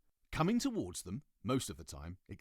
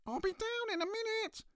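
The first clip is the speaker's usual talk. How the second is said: much higher, squeaky little voice. Acted. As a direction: squeak it out from upstairs. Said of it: "came a high, squeaky voice".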